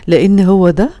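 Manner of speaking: ordinary talk, nothing marked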